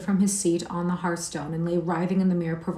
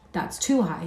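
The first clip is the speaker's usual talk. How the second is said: usual speaking voice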